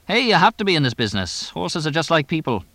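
high, quick trainer voice; fast-talking English trainer. Acted. Trainer explaining horses to the owner, high quick chatter. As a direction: pitch it up and rattle it off.

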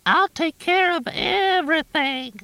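high mimicking falsetto, like a parrot; parrot-like falsetto mimicry; mocking falsetto. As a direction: squeak it out to mock the caller. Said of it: "Junior mimicked in a falsetto".

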